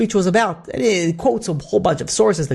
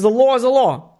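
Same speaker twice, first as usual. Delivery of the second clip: firm declaration; 7 semitones higher than usual. Retold or imitated. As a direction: state the law as final, no room to argue. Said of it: "he said very firmly".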